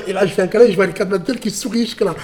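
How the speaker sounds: plain reading voice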